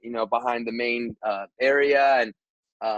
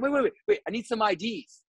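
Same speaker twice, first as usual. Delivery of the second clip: much higher, quick repeated waits; urgent calling back; 7 semitones higher, faster than usual. Retold or imitated. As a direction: stop everyone in a hurry.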